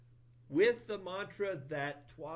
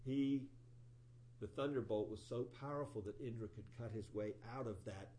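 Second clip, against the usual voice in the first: normal talking voice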